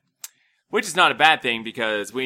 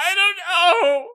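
mock tearful; 6 dB louder, faster than usual. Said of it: "I'm like".

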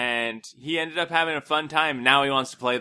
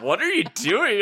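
baffled wife; 7 semitones higher than usual. Retold or imitated. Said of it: "Crystal's looking at me like".

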